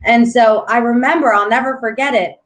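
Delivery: usual speaking voice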